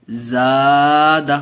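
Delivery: زاد said slowly with a long aa; teacher sounds out زاد again; slow model word. Shown as a demonstration. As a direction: stretch زاد out, one full alif long. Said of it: "دیکھیے کیسے پڑھیں گے".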